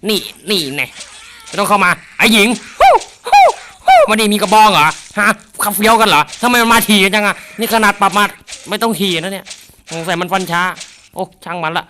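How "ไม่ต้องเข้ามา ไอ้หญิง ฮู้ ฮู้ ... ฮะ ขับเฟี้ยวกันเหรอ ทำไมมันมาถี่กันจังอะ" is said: higher, louder taunts at the zombies; excited taunting. Real, not acted. Gamer taunting charging zombies, loud and excited. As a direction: egg the zombies on, fired up.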